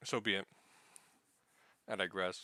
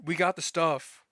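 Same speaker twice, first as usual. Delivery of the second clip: retold calling out; 7 semitones higher, 5 dB louder, slower than usual. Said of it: "she goes up, he's like".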